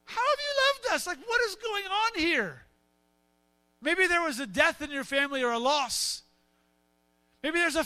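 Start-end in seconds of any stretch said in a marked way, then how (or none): 0.0-2.7 very high, exasperated whine; high-pitched acted frustration; frustrated complaint. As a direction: throw it up at God, fed up. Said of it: "you're just so frustrated with your situation".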